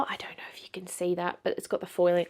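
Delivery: soft unvoiced whisper while showing the book; hushed whisper to camera. Real, not acted. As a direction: murmur it like a confidential aside. Hushed aside while showing the cover.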